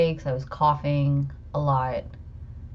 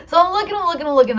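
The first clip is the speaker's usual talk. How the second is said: much higher and louder, laughing through the frantic search; laughing retelling; 13 semitones higher, 8 dB louder, much faster than usual. Real, not acted. Mother laughing as she recalls searching her son's nose. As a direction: giggle while reliving the panic.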